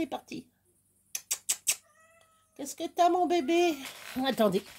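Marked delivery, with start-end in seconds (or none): 2.5-3.8 pet talk. Real, not acted. Cooing to her pet.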